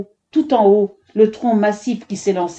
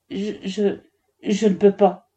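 stammering. A narrator's reading. Woodcutter refusing to strike the girl, stammering. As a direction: falter on the first word, with pauses. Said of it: "Le bûcheron bafouilla".

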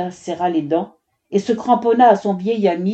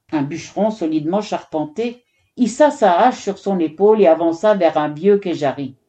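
ordinary delivery

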